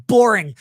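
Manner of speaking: "Boring" said alone, loud and falling; punched Boring. Real, not acted. Sneering at a rival's name with a loud "Boring". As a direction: slam the word "Boring" down. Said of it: "Emphasis on boring".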